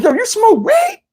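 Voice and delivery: high-pitched, drawn-out disbelief; incredulous question. Retold or imitated. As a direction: ask it in a high, baffled voice. Storyteller acts his startled question.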